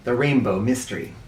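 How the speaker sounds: usual speaking voice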